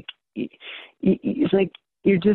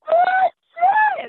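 high, loud shriek of shock; a screaming Oh, shit pitched far up; retold scream; 6 dB louder than usual. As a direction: let it rip way up high and loud. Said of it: "And I started screaming".

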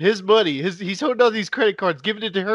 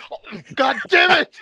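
loud, exasperated curse; mock outburst; 5 semitones higher, 3 dB louder than usual. John cursing about his wife's spending, loud mock exasperation. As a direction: blurt it out like he just lost it. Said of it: "John's like".

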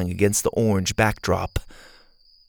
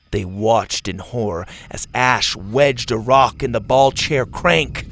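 ordinary delivery, nothing marked

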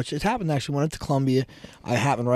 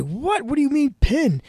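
outraged what; 14 semitones higher than usual. Retold or imitated. Father hearing the ref call a pin on his son, high outraged cry. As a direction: shout it like you can't believe the call. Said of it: "You didn't say it like that 'cause I know you".

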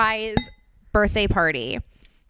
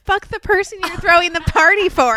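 mock exclaim; 7 semitones higher, 5 dB louder than usual. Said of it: "What did you think I said?".

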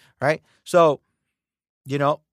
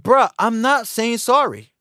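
defiant [name]; 6 semitones higher than usual. Retold or imitated. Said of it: "He's like".

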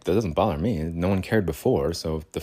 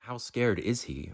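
ordinary talk, nothing marked

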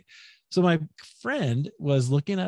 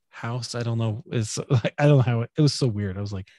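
ordinary talk, nothing marked

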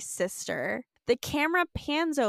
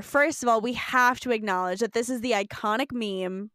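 ordinary talk, nothing marked

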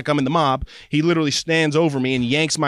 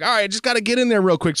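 breezy dentist; 7 semitones higher, faster than usual. Retold or imitated. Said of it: "he's like".

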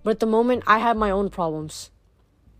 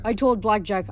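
plain reading voice

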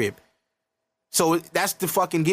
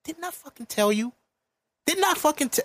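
much higher, rising frustration; I told you so, cut off mid-word; mock blowup at a friend who didn't listen; exasperated outburst; 11 semitones higher than usual. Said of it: "just, like, shocked because it's like".

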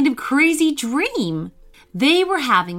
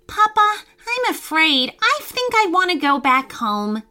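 put-on high, scared child pleading; 8 semitones higher than usual. A narrator's reading. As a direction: beg to go home. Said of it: "he said in a pleading voice".

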